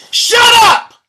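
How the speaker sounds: yelled shut up. Real, not acted. Ranting at a news headline.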